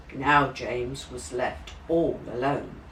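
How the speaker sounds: ordinary delivery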